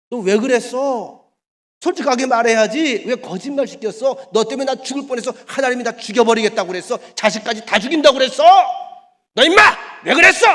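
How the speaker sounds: loud, high furious rebuke; loud high angry king's rebuke building to a yell; king's scolding. Acted. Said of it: "야단치는 장면".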